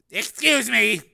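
flustered apology; a nervous man clearing his throat; uneasy engineer. A narrator's reading. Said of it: "clearing his throat uneasily".

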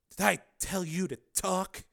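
threatening, controlled reprimand; ominous. A narrator's reading. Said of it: "said in an ominous tone".

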